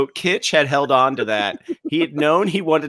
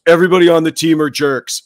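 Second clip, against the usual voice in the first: blurted line; 3 semitones higher, 8 dB louder than usual. Retold or imitated. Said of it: "he goes".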